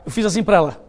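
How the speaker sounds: normal talking voice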